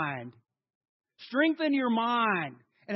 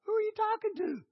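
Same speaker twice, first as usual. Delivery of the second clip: surprised question; 12 semitones higher than usual. Retold or imitated. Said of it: "I walk in there and say".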